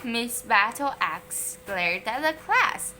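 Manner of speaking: ordinary delivery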